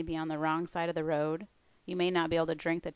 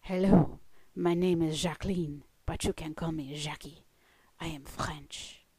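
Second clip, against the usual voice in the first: put-on French accent. Speaker imitating a French woman from church, French accent. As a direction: introduce yourself with a gentle French accent. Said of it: "respond with her French accent".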